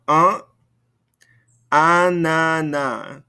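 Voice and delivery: "ananas" drawn out, slow and bouncy; playful. Shown as a demonstration. Teacher models a fun word for the class, "ananas" drawn out slowly. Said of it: "That's a fun one to say".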